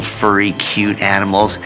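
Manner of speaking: usual speaking voice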